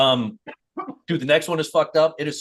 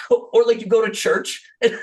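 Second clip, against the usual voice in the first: usual speaking voice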